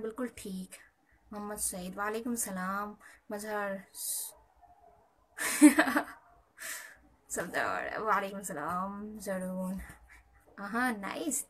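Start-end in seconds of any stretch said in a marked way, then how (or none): 5.4-6.1 short, high laugh; sudden loud chuckle. Real, not acted. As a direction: burst into a loud little laugh. Laughing at a chat comment.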